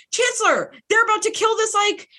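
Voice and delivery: high, hurried mock urgency; a lightly voiced urgent plea. Imitating how she should have burst in, urgent and high. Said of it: "be like".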